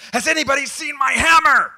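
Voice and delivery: a god in a full panic, shouting; panicked shout. Acted. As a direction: yell it like you've lost everything. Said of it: "he freaks out".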